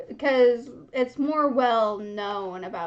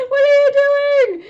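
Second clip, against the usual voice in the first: much higher, loud laughing squeal; laughing disbelief; 14 semitones higher, 13 dB louder, much faster than usual. Real, not acted. Reader laughing hard at Grover's antics, high and loud. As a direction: crack up mid-question.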